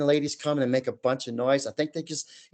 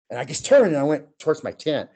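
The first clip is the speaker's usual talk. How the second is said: ordinary talk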